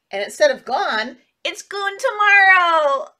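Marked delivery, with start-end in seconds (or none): 1.4-3.1 loud, high comic punchline; punchline shout. Acted. Teacher shouting the silly punchline to children. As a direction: belt out the joke.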